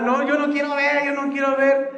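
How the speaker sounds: high, pleading, hiding in fear; a frightened man refusing to look; scared Pedro. Acted. Pedro hiding from the ghost, high scared pleading.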